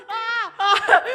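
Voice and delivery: short, high, loud screams; panicked scream. Real, not acted. Player attacked by the murderer, panicked scream. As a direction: scream in alarm.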